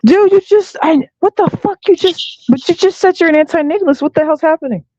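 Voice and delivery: high-pitched, baffled outburst; stunned disbelief. Real, not acted. Host reacting to a pastor's confession, stunned. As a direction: blurt it out, voice shot up in shock.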